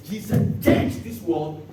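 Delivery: ordinary delivery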